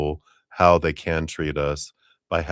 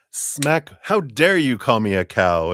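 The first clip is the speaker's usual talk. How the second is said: mock outrage; 6 semitones higher, 4 dB louder than usual. Acting out an offended slap.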